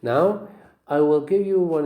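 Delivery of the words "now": ordinary talk, nothing marked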